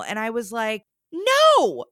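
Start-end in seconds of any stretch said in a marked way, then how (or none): 1.1-1.8 high, loud retold NO; emphatic refusal. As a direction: blurt out an emphatic no. Emphatic refusal of date's suggestion.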